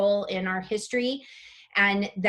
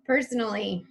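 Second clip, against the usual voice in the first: ordinary talk, nothing marked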